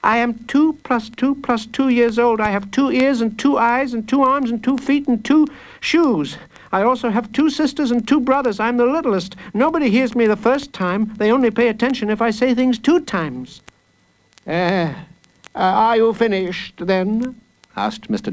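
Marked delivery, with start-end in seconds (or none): 0.0-13.6 put-on high, earnest child's recital; pleased little boy. A narrator's reading. Small boy happy to explain himself, earnest. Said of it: "pleased to be able to explain himself". 14.5-17.4 high, hesitant pompous query; hem, then ask in a high voice; weary judge. Acted. Judge checking the boy is finished, testy.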